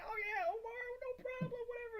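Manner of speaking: imitating the successful seller shrugging it off, very high and breezy; mock Tony voice. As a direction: toss it off in a silly high-pitched imitation. Imitating Tony's breezy reply.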